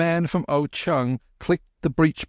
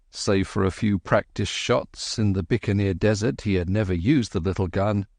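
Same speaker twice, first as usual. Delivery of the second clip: ordinary delivery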